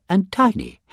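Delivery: squeaked high on tiny. A narrator's reading. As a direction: make tiny sound tiny and high. Sizes voiced big to tiny.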